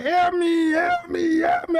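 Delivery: frantic wailing plea for help; crying for help. Pretend. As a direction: beg like you're trapped and terrified. Trapped voices crying out.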